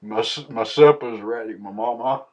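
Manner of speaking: ordinary talk, nothing marked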